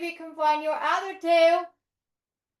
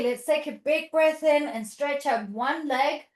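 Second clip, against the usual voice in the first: ordinary talk, nothing marked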